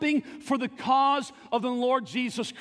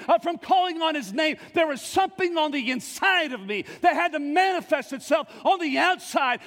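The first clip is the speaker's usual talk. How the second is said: preaching at full force; 4 semitones higher than usual. Acted. Pastor voicing Jeremiah's unstoppable fire, full-force shouting preaching. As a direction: let it burst out with fervor.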